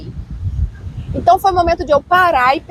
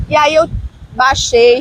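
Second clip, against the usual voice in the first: normal talking voice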